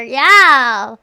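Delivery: loud, drawn-out yeah; loud yell. Real, not acted. Streamer joking Australia isn't real, loud yell. Riling chat, Australia joke.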